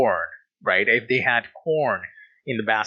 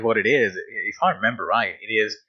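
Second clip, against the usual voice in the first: ordinary delivery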